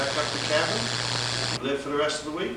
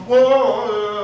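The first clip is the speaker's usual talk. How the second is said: loud, high string of whoas; mock alarm; 5 semitones higher, 8 dB louder, much slower than usual. Reaction to being asked if he killed anybody, mock alarm. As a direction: act taken aback, voice jumping up. Acted reaction to asking about killing.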